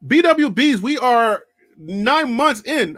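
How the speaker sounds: loud, slow hype call to the fans; rally the fan base, loud and drawn out; slow, loud shout-out to fellow fans; loud callout. Real, not acted.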